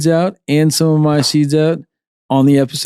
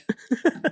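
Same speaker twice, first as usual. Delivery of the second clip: soft giggle; 13 semitones higher, 9 dB quieter than usual. Real, not acted. Blooper, too high.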